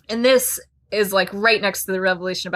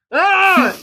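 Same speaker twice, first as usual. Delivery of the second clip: high mock gasp; 10 semitones higher, 8 dB louder than usual. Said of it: "I will read them".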